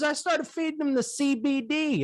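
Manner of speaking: high folksy farmer voice; dairy farmer. Retold or imitated. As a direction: lift the voice way up for the farmer. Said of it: "He goes".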